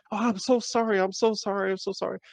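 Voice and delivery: high-pitched, mock-tearful repeated apology; imitated tearful apology. Cashier grabbing his hand in tears, imitated tearful apology. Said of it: "she was in tears".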